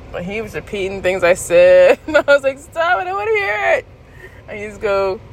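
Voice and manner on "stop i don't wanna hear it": high, stretched plea; playful high-pitched complaint; whiny protest. Retold or imitated. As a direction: squeal for the teasing to stop. Acts out protest at being mimicked.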